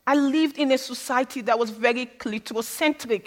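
ordinary delivery

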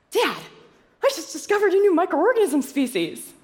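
high-pitched, excited announcement to dad; gushing re-enacted excitement, pitched very high; excited daughter. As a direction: burst out with thrilling news, voice way up high. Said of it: "I was so excited".

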